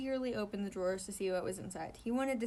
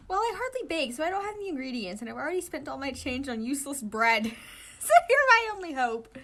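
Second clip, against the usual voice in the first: pleading; 6 semitones higher, 9 dB louder than usual. Acted. Pleading for help.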